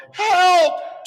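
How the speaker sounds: loud high cry for help; yelled. Acted. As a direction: call out falling through the sky. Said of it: "Ernie yelled".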